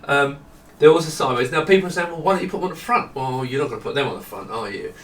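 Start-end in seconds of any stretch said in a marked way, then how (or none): none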